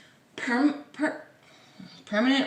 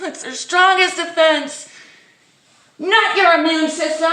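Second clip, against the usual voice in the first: much higher and louder indignant rant, stress on strongest; mock outrage; 9 semitones higher, 9 dB louder than usual. Vlogger ranting at the health message, mock outrage.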